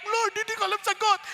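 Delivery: high, pleading mock prayer; lightly hammed-up desperate student; mock tearful plea. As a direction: pretend to wail to God in exam panic. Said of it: "iyak na iyak".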